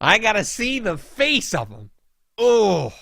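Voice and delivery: worked up, pitched high; exasperated. Real, not acted. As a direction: let the irritation burst out. Worked-up rant about the percher.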